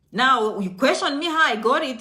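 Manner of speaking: ordinary delivery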